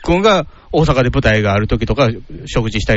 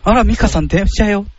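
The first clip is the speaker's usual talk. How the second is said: mock elegant lady; 7 semitones higher than usual. Acting a Kano sister aloud.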